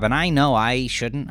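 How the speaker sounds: ordinary delivery, nothing marked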